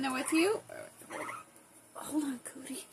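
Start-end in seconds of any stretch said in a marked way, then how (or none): 1.9-2.8 soft whispered aside; whisper to pet. Real, not acted. Owner whispering to her bird Cody to hold on. Softly asks the bird to wait.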